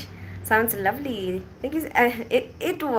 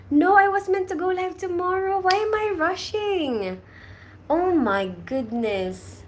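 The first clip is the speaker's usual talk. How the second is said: flustered realization; 10 semitones higher, slower than usual. Real, not acted. Realizes she mixed up live dates.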